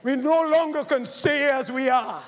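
ordinary talk

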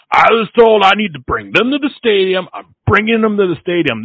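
high, loud mock protest; imitated insistence. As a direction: act out a driver arguing back. Acted driver insisting back at her.

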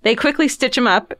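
ordinary delivery

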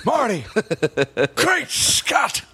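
high excited mad-scientist cry; Doc Brown impression. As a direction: shout it like a wild old inventor. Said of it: "Doc from Back to the Future".

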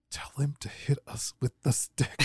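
whispered instruction through a laugh. Retold or imitated. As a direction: sneak the order to the referee. Bad guy whispering instructions.

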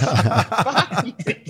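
high mock snap, breaking into a laugh; Phil Kessel voice. Phil refusing the weigh-in, laughing high mock voice. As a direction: repeat it, laughing through the high voice. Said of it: "make sure you do his voice".

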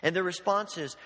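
ordinary talk

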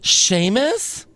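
mock interviewer's puzzled rising question; JoJo. Podcaster plays interviewer checking on laughing wrestler, mock puzzlement. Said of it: "And then JoJo says".

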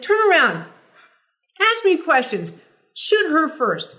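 high, loud brash commands; McEnroe impression. McEnroe ordering the crew to film her, high and loud. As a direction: bark orders like an impatient star. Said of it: "He's like".

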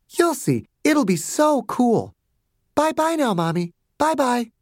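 put-on very high sing-song little girl; breezy child dismissal. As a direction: brush Mommy off sweetly like a child. Child cheerfully dismisses Mommy.

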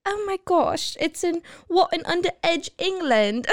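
high-pitched, hammed-up posh English; badly faked upper-crust British accent; posh Bridgerton accent. Host playing a Bridgerton character, fake posh English accent. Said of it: "talking like Bridgerton people".

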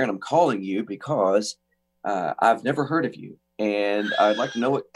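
imitating a low, deliberate phone voice; slow, low impression of a man introducing himself; deep-voiced man. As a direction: drop way down to mimic a deep-voiced caller. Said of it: "deep voice".

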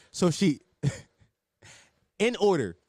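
normal talking voice

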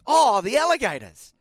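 drawn-out teasing imitation; mocking repeat. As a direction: mimic him to tease. Friend mocking the reply.

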